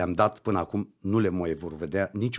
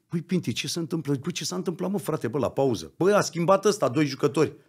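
higher, pressing reproach to Pinti; retold heated questioning; 6 semitones higher than usual. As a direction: demand answers from Pinti, worked up. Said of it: "am vorbit cu Pintili".

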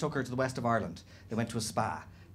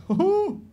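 high, louder mock "hoo-hoo"; gleeful hoot; 7 dB louder than usual. Stag gloating to his best friend, gleeful hoot. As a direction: hoot like an imitated giddy onlooker.

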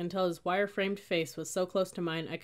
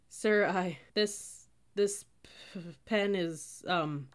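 nervous stammer; 2 semitones higher, much slower than usual. A narrator's reading. Cadet tries explaining the broken pen, stammering nervously. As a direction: stumble and stall under pressure.

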